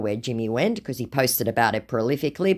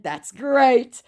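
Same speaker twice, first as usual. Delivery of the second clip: high, slow, syrupy put-on voice; mock scammer voice; 11 semitones higher, 3 dB louder than usual. Woman reading the scammer's message aloud, in a high mock voice. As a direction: ham up the creepy flattery.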